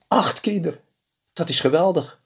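high, happy exclamation; delighted. A narrator's reading. Reynold thrilled about eight children, high and bright. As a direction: burst with joy.

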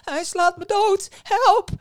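loud, high wail for help. A narrator's reading. Innkeeper beaten by the club, wailing for help. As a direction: cry out as if being beaten. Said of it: "huilde de herbergier".

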